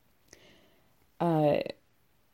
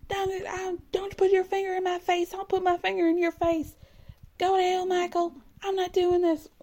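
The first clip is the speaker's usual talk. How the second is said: high, screechy mocking rant at the husband; much higher, hammed-up nagging wife; screeching Angela; 8 semitones higher than usual. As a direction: shriek it like a bratty wife who won't back down.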